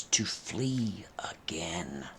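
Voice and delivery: slow hushed whisper; slow, soft, whispered. A narrator's reading. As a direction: breathe it out slowly. Whispered in tense narration.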